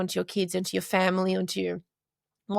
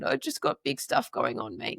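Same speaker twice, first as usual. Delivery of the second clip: lightly voiced, lower and softer, worn-out reply; tearful reply to son; 8 semitones lower, 4 dB quieter than usual. Mother answering her young son at the park, a hint of low, soft tearfulness. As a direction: speak low and quiet, as if drained from crying. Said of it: "And I said".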